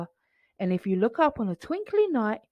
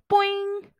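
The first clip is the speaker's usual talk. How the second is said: bouncy boing; 10 semitones higher than usual. A narrator's reading. Bouncing sound word.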